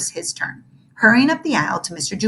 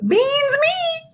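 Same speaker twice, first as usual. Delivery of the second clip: put-on silly kid voice. Silly kid character line.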